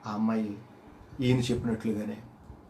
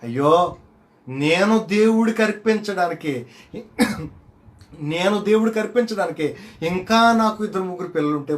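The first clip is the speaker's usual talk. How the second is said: slow, loud, high wail of longing; anguished cry; 7 semitones higher, 9 dB louder than usual. Retold or imitated. Mother crying out to the preacher, slow loud lament.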